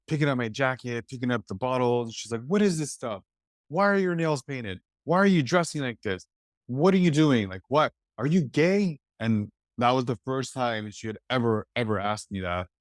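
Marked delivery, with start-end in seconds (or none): 2.5-9.0 imitating a mother's high-pitched questions; mock-angry mother, voice pitched up; mother yelling. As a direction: fire off the questions like a shocked, scolding mom. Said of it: "was, like, yelling".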